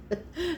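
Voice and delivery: a quick soft giggle at her own slip; embarrassed giggle. Real, not acted. Reader laughing at her own stumble over the word, soft giggle. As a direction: laugh off the flub.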